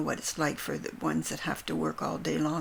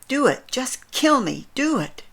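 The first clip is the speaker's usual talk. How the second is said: loud and much higher, begging to die; retold desperate scream; 7 semitones higher, 9 dB louder than usual. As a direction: cry out in despair, louder and higher. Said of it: "screaming it with such desperation".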